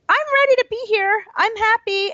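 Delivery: put-on high, fast little girl voice. Preschool girl telling her teacher she is ready, high and quick. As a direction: chirp it like a proud preschooler. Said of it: "she goes".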